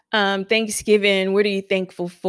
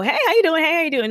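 high cheery hello; friendly greeting; 10 semitones higher, much faster than usual. Retold or imitated. Greeting strangers at the event, high and bright. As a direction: greet them brightly and keep moving. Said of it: "I don't just go".

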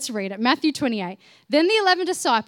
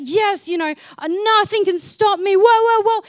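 louder, higher, rising to a pumped-up whoop; fired-up mock hype; 5 semitones higher, 9 dB louder than usual. Disciples imagined as fired up, cheering loud. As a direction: ham up an overexcited crowd. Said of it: "fired up and just like".

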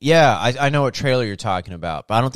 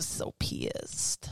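whispered; 11 dB quieter than usual. Real, not acted. Grumbling about a movie.

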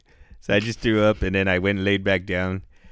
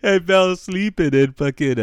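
high, louder words through real laughter; laughing; 10 semitones higher, 6 dB louder than usual. Real, not acted. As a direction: crack up while saying it. Cracking up at own story.

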